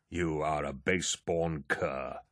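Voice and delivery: low, soft and slow, venom in every word; hissed insult. Acted. Eidolon insults Torgadden, hissed low and slow. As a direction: hiss the insult through contempt. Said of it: "Eidolon hissed".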